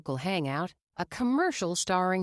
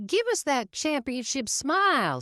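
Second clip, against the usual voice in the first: eager photographer calling; 8 semitones higher than usual. A narrator's reading. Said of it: "said another".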